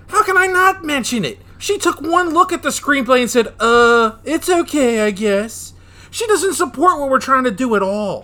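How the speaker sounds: high, loud, exasperated rant; indignant outburst. Acted. Told not to mention the screenplay, explodes indignantly. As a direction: protest at full pitch, voice shooting up.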